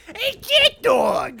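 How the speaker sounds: very high, loud, drawn-out mouse call; little cartoon mouse. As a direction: holler as a squeaky cartoon mouse. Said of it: "voice of that little blue mouse from Cat Dog".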